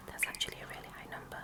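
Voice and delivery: fast, hushed, amused side remark; quick whispered aside. Acted. Poet comments on the friend count in a quick hushed aside. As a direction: rattle it off under the breath.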